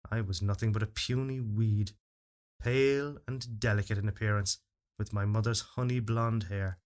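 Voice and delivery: ordinary delivery, nothing marked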